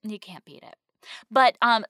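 ordinary talk, nothing marked

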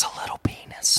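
unvoiced, secretive whisper. Real, not acted. Joking secret whispered aside.

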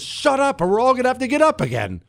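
high, fast irritated shush; mock hushing threat. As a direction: snap it out quick and high to silence him. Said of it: "our response was".

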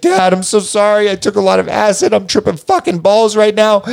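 high-pitched mock-panicked apology; retold frantic confession. Tripping teen confessing to his dad, mock frantic apology.

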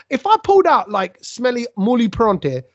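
ordinary talk

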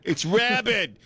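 high mock yell in a Northeastern accent; poorly faked Northeastern warning cry; attempted Northeastern accent. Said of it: "I can't do a Northeastern accent".

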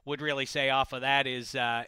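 ordinary delivery

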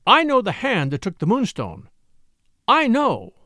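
"I know" hit hard and pitched high; strong emphasis. A narrator's reading. Rachel naming what she knows, "I know" heavily emphasized. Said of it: "She laid a strong emphasis on the words".

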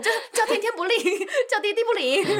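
high, laughing wail; laughing lament. Pretend.

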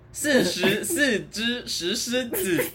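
slow, deliberate syllables; slow careful tongue twister. Shown as a demonstration. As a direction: spell out each word slowly. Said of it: "我绕口令超准哦".

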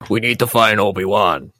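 lightly voiced low villain commander; Darth Vader. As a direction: drop the pitch for a hint of dark overlord. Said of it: "they're gonna cut to Darth Vader".

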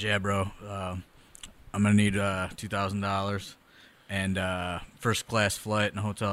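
lazy meathead listing his fee; low bro voice. Retold or imitated. Matt Morgan making his demands, a low lazy bro. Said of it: "He goes".

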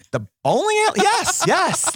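high-pitched, delighted outburst; light up with thrilled recognition; excited recognition. Retold or imitated. Server realizing he knows her hometown bowling alley, excited.

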